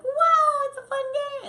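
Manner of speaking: very high, loud giddy puppy; high giddy dog voice; excited puppy voice. Retold or imitated. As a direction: squeal it like a thrilled puppy. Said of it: "the dog's like".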